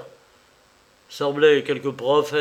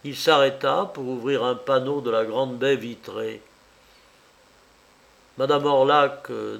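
plain reading voice

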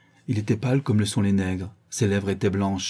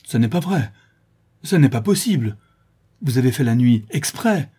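higher, louder angry denial; crying out; 4 semitones higher, 5 dB louder than usual. Acted. Brigand struggling in his chair, outraged denial. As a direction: refuse to believe it, crying out. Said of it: "s'écria le brigand".

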